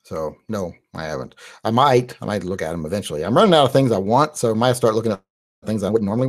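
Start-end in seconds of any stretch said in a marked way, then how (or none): none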